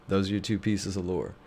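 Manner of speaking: normal talking voice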